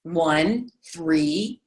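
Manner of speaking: ordinary talk, nothing marked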